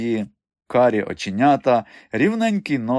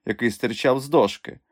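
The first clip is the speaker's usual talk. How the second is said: plain reading voice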